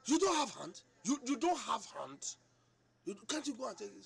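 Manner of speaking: high, loud, scornful demand; angry retort. Retold or imitated. Wife telling husband to fetch his own food, scornful and loud. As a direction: bark the question back at him.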